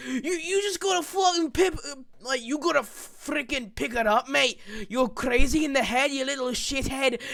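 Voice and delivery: put-on angry yell in a Russian accent; high, shouting Russian accent. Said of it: "in a Russian accent".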